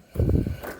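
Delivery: a soft embarrassed sigh; a sigh at his own slip-up. Real, not acted. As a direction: let out a quiet sigh. Sigh after embarrassing mistake.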